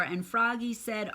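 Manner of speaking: plain reading voice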